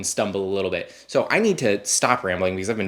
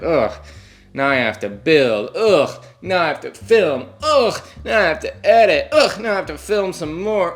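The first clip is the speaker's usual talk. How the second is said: whiny high complaining voice, sighing ugh; mock yourself as a whiny baby; dramatic sigh; 9 semitones higher, 3 dB louder, slower than usual. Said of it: "Ugh, poor me".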